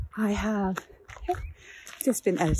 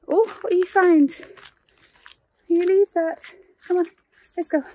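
higher and louder, alarmed; calling to the dogs; 11 semitones higher, 7 dB louder than usual. Real, not acted. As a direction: stop the dogs from eating it. Dogs found something on walk.

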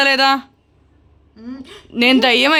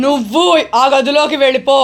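insistent ghost order. Pretend. Repeated order, drawn out.

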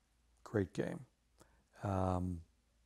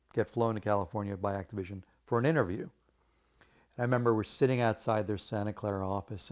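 ordinary delivery

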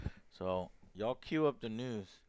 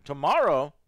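surprised; 8 dB louder than usual. Real, not acted. Echo of tomorrow, louder.

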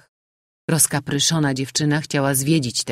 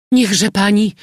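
much louder, higher urgent cry; exclaiming; 6 semitones higher, 8 dB louder than usual. A narrator's reading. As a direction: urge the landlady at once. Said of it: "wykrzyknęła margrabina".

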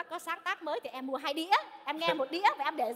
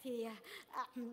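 soft, slow, halting; nervous; 9 dB quieter, slower than usual. Acted. Starstruck woman addressing her idol, nervous. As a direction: hesitate softly, lost for words. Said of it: "em thật sự em run quá".

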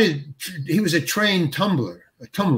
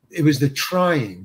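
ordinary delivery

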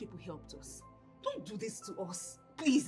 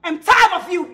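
yelled at full force, higher; angry shout at the husband; furious shout; 5 semitones higher, 20 dB louder than usual. Acted. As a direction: scream your exhaustion at him. Wife fed up with husband.

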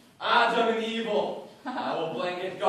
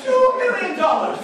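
excited shout; 11 dB louder than usual. Acted. Bidding war, shouted high bid.

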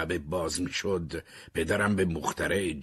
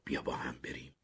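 softer, unvoiced whisper; 3 semitones lower, 8 dB quieter than usual. Terry whispering softly to Caroline. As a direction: breathe it quietly to her. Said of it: "تری آهسته به کارولین گفت".

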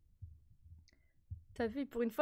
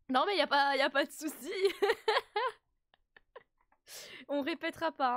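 louder, laughing "y a pas de souci"; laughing reassurance; 4 semitones higher, 4 dB louder than usual. Real, not acted. Streamer to chat, brushing it off with laughs. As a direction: laugh it off loudly.

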